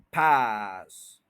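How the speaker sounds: पास stretched very slow, full energy; drawn out. Shown as a demonstration. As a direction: hold पास long and strong. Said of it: "पूरी energy से बोलें".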